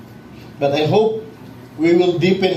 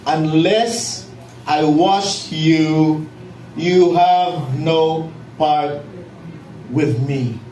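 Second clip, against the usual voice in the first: very slow, low, solemn Jesus; gentle rebuke; 3 semitones lower, much slower than usual. Retold or imitated. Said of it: "And Jesus answered".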